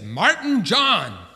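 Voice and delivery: loud, slow stern call of a name; stern full name. Retold or imitated. Mom scolding her son by his full name, loud and stern. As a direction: call him out like a mom who's had enough. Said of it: "I knew that I was in trouble".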